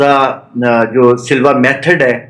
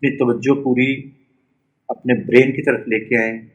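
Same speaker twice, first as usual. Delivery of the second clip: guided meditation; 4 dB quieter than usual. Real, not acted. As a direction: lead a body scan one short phrase at a time.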